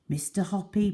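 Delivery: ordinary delivery, nothing marked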